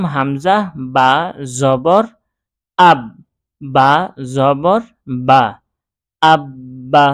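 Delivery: measured recitation ending in a doubled b in আব্বা; paused spelling drill building up to আব্বা. Shown as a demonstration. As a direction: spell হামজা, বা, জবর piece by piece, then join as আব্বা. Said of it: "তাহলে প্রথমে পড়লাম".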